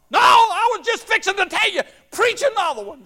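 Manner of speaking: put-on high, loud excited shout from an old woman; excited holler. Old lady jumping up in church, shouting excitedly. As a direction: holler it out like she just jumped up. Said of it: "jump up and holler".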